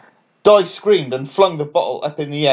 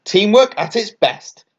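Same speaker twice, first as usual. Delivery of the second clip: gushing; 6 semitones higher than usual. A narrator's reading. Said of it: "gushed Dot".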